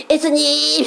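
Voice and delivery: high, held 예수님 kept up in the throat; call not from belly. Shown as a demonstration. Mother shows how children fail to shout, unsupported call. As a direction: imitate calling 예수님 without belly support. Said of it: "배에서 나오는 게 아니라".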